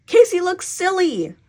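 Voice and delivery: high, loud, drawn-out complaint; indignant child. A narrator's reading. Jessie objects to Mama about her brother, indignant. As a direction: whine it slowly in protest.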